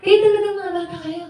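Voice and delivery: high, mock-angry scolding, lightly voiced grandma. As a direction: nag them like a fed-up lola. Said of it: "Sabi niya".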